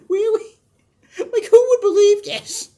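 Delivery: high, loud incredulous mock; mocking disbelief.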